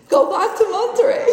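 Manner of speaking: high, loud retold chant through laughter; group shout. Passengers join her demanding to turn back, laughing shout. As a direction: yell it like a whole cabin, laughing. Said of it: "people started shouting with me".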